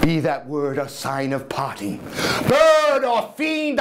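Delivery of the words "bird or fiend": slow, high, loud shriek. Acted. Narrator leaps up shrieking at the fiend, high and loud. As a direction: rise up and shriek it. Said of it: "I shrieked upstarting".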